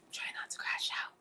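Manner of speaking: whispered to herself, fast. Real, not acted. Vlogger calming herself mid-rant, whispered. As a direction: hush it as a self-reminder. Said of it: "I gotta keep breathing".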